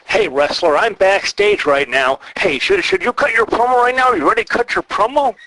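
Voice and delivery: mock a bad indie backstage interviewer; over-bright fake TV announcer; cheesy interviewer. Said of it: "why are we talking like that?".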